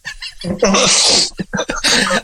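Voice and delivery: breathy burst of real laughter; laughing. Real, not acted. Laughing at the memory of the car insult. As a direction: laugh it out helplessly.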